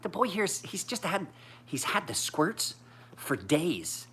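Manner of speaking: high, mock-sweet church lady gossip; grandma fretting to the church ladies, high and sugary; grandmother voice. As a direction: imitate a fussy grandmother cooing. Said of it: "It's like".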